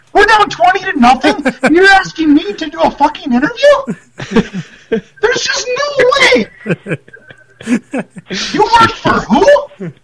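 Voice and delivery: high, shouted mock outburst, slowing down; imitating shouting coach. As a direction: yell it in disbelief like a furious old coach.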